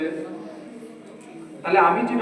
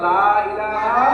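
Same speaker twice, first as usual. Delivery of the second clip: very slow, louder "লা ইলাহা" drawn out; slow recitation demo; 4 dB louder, much slower than usual. Shown as a demonstration. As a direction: stretch it out for the children. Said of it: "কালমা তৈয়বা". (ধীরে টেনে জোরে "লা ইলাহা")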